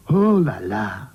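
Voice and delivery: put-on slow, teasing French-accented ooh la la. French doll teasing a friend, slow French accent. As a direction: drawl it out like a cheeky Frenchman.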